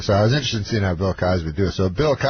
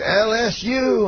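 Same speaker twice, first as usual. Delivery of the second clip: higher, slow Cosby crowd call; Cosby teasing crowd; 10 semitones higher, slower than usual. Retold or imitated. To the Tulsa crowd, slow mock Cosby taunt. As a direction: spell it out slowly to bait boos. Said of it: "And everybody boos".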